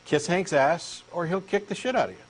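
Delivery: ordinary talk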